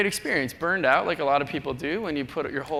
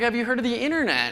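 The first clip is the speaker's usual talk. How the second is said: higher mock condescending voice; condescending stranger; 7 semitones higher than usual. A traveler talks down to the speaker, higher mock voice. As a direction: talk down to the country kid. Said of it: "people are like".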